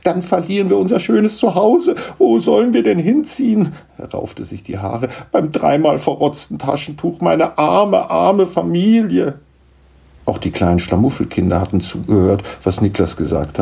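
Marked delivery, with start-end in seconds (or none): 0.0-3.7 high, distressed lament; wailing. Acted. Gnome father about to lose his home, wailing. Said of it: "jammerte Schlammupf". 5.3-9.4 high lament, drawing out at the end. Acted. Gnome father mourns for his poor family, lamenting. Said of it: "jammerte Schlammupf".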